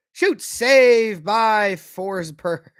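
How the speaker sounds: loud, drawn-out play-by-play call; real commentator excitement, slow and loud; excited save call. Real, not acted. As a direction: stretch the words out over the big save. Calling a shot and save.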